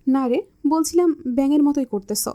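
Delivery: ordinary delivery, nothing marked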